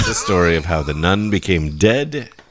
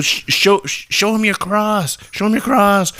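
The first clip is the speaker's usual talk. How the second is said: high, urgent stammering priest; panicked mock yelling; 12 semitones higher than usual. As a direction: blurt it out in comic panic. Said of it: "screaming their head off".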